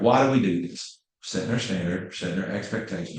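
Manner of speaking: ordinary talk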